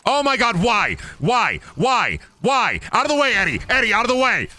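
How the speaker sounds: shrieking for the companion to move; real panicked shouting. Real, not acted. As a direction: yell in genuine panic at the screen. Panicked at game enemy.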